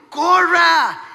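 high, loud acted command; urgent shout. Husband ordering wife to run, mock urgent shout. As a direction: shout it like there is no time left.

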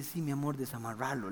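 gently coaxing her husband to untie him; a hint of a sweet, soft-spoken wife; soft tender mother. Gentle wife voiced in story.

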